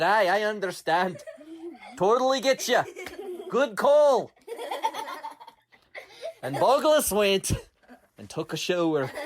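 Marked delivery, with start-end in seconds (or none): 0.0-4.3 resigned Scottish bug. Acted. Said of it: "he said".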